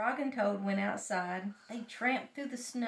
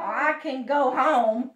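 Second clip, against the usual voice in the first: loud, slow, drawn-out announcement; emphatic declaration; 10 dB louder, slower than usual. A narrator's reading. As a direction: declare it loudly, word by word. Toad's emphatic grumpy punchline.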